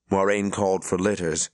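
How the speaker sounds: plain reading voice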